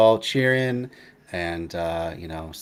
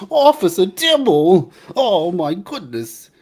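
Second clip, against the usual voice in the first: much higher, louder and slow cartoon New York voice; Top Cat accent; 10 semitones higher, 9 dB louder, slower than usual. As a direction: belt it out like a cartoon alley cat. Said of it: "kind of a Top Cat accent".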